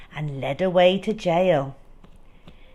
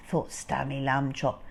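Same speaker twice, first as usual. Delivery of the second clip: ordinary delivery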